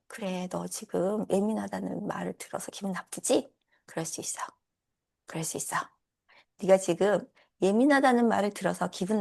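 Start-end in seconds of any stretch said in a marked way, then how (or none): 0.1-5.8 whispered, soft and gentle; soothing whisper. Shown as a demonstration. Coach whispers comfort to her own hurt self, tender whisper. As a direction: hush it like comforting a hurt friend.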